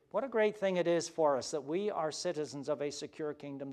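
ordinary delivery

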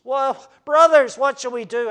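loud, slowed cry of the crowd. Acted.

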